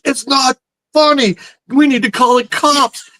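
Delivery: high, upset imitation of the prank victim; mock distress. Pranked friend to his laughing crewmates, mock distress.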